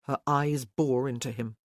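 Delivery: normal talking voice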